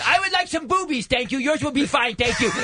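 exaggerated impaired speech, pitched very high; high, strained, hammed-up diner order; Mike. Comic friend pranking a waitress who talked past him, exaggerated impaired voice. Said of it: "he cranks the level of reach on up three thousand percent".

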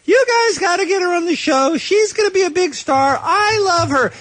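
high-pitched mock gushing fan; Nick. Host quotes the fan's pleading email, mock gushing. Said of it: "as Amber Heard's number one fan, was emailing us saying".

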